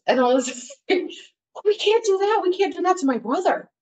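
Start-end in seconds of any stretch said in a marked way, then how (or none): 1.6-3.7 high-pitched, distressed objection; pleading protest. Retold or imitated. Woman begging boyfriend not to spoil brother's wedding, pleading protest. She pleads against getting kids.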